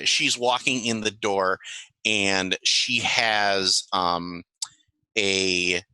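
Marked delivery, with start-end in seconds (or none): none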